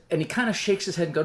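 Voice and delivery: usual speaking voice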